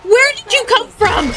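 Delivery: high-pitched, alarmed demand; startled cry. Real, not acted. Player to a robot that popped up from nowhere, startled. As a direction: burst out high in shock.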